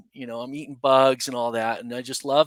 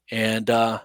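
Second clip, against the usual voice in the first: normal talking voice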